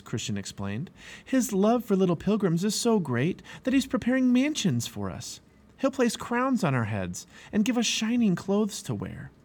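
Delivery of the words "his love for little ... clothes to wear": high-pitched, enthusiastic telling; eager explanation. A narrator's reading. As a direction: describe wonders keenly.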